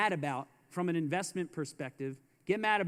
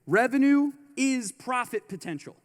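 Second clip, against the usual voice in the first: louder, punched revenue is profit potential; emphatic; 4 semitones higher, 6 dB louder than usual. Shown as a demonstration. To the audience, repeating the key line louder. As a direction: hammer the line home louder. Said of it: "Remember this".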